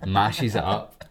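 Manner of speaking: ordinary talk, nothing marked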